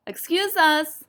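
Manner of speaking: storybook shout. A narrator's reading. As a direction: call out high to someone over noise. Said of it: "shouted Possum".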